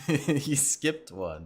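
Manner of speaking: laughing through the words. Real, not acted. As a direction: chuckle it out, slowly. Laughing at skipped count.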